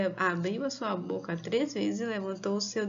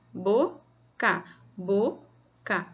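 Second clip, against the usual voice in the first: "boca" broken into bo and ca; a pause after each syllable of "boca"; much slower than usual. Shown as a demonstration. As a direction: sound out "boca" in two separate beats. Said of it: "cada uma dá um som".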